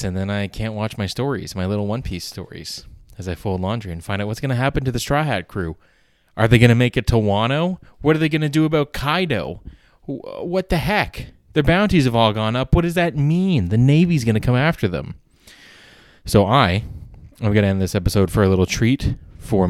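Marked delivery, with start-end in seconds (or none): 4.3-15.2 excited. Real, not acted. Excited about One Piece plot.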